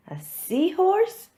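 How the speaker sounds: surprised question. Acted. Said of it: "says Pete".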